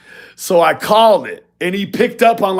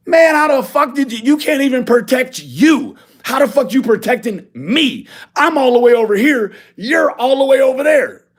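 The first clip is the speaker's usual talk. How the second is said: higher, exasperated comeback; heated retort; 7 semitones higher than usual. Retold or imitated. Arguing with the caller on the phone, heated and higher. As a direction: throw the question back at him, incredulous.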